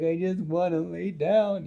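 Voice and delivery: high-pitched, put-on whine; worn-out whine. Peter whining, worn out.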